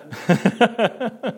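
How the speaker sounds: high chuckle; real laugh. Real, not acted. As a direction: laugh at your own joke. Laughs at own acted quote.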